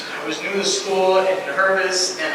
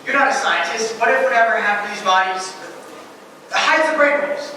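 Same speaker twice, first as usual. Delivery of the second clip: raised, higher voice, pushing back; desperate arguing; 7 semitones higher, 4 dB louder than usual. Acted. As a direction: argue back louder, rising in desperation. Desperate argument against bad medical news.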